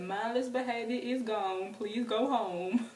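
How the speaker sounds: slow, drawn-out official announcement; policeman announcement. Retold or imitated. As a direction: imitate an officer droning to a crowd, very slowly. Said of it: "That's exactly how".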